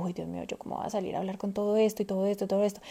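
quick, hushed, panicky rush of words; worried self-doubt. Retold or imitated. A woman doubting herself before meeting suppliers, quick and hushed. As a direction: fret under your breath, fast and quiet. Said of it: "nos pasa a nosotras las mujeres que es como".

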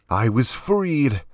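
high, slow strained gasp; choked-out, drawn-out protest. A narrator's reading. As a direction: force it out while short of air. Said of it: "Jax gasped".

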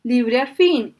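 very loud, high booming cry; booming genie. A narrator's reading. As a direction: shake the mountains with the voice. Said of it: "haciendo retumbar las montañas con su voz".

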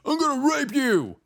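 high, loud put-on menacing voice; mock threat from a prison inmate, high and loud.

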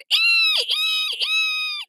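very high sustained "ee" squeals; toddler's long shrill squeal; continued screeching. A narrator's reading. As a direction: keep the piercing screech going. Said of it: "Tootsie kept it up".

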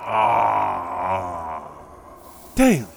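loud fed-up groan then a curse; exasperated groan. Real, not acted. Host annoyed that the heat-loving guest has shown up, loud exasperated groan. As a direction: groan like the pest just showed up. Annoyed at unwelcome visitor arrival.

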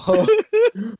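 high giggle over the sad news; amused, high-pitched laughter. Real, not acted. Laughing over the last homework.

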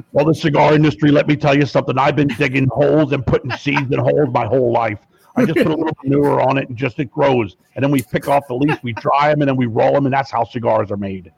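folksy lecture as Jesse the Body; a hint of the wrestler-governor; Jesse Ventura impression. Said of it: "when you're Jesse the Body".

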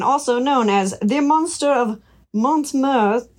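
ordinary talk, nothing marked

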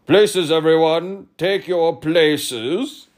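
grumpy royal command; irritable call. Acted. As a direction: bark orders at the court. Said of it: "calling irritably".

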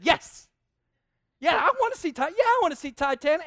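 high, loud mock enthusiasm; hammed-up eager agreement to please; fake eager yes. Pretending eagerness to wife.

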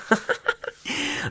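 high, soft breathy giggle; quick, high burst of tickled laughter; amused giggle. Real, not acted. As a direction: giggle helplessly mid-explanation. Laughs at own scooter story.

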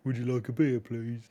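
put-on a light generic American accent. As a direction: offer a beer as a bland American bartender. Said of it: "I'll just go with my generic".

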